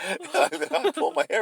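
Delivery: giggling through the words; laughing. Real, not acted. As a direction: laugh out the line. Retelling the hair pulling, laughing.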